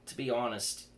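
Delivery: plain reading voice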